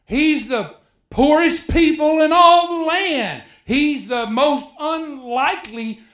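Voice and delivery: high, pleading little-man voice; a hint of a whiny Gideon putting himself down; Gideon's self-doubt. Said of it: "That's what he would say".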